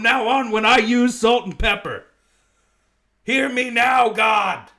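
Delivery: loud, high mock cry to the heavens; hammed-up shout to God; mock proclamation. Comedian announcing salt and pepper to God, shouting.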